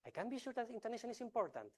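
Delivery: same words, high and emphatic; different intonation. Shown as a demonstration. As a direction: lift the whole line up high to send another message. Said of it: "same sentence in a book, but I'm sending two different messages".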